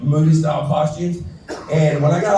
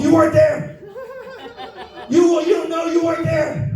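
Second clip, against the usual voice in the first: hammed-up war trauma; 13 semitones higher than usual. Mock veteran shouting at the Marine, high and laughing. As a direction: play the haunted vet, cracking up.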